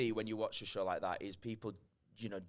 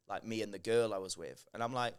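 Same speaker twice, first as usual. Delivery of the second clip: normal talking voice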